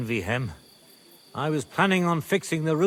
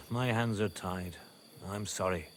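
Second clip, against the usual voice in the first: soft, low apology; regretful refusal; 6 semitones lower, 11 dB quieter than usual. Acted. Mine owner turning down a plea for help, quiet regret. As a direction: drop the voice low and soft, resigned.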